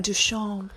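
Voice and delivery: "Duchamp" as doo-SHON, nasal ending, no p; French Duchamp. Retold or imitated. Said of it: "when I was like".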